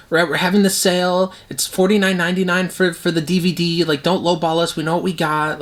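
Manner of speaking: a lightly voiced cocky retailer; smug seller. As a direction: play a seller who won't haggle. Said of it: "now they're like".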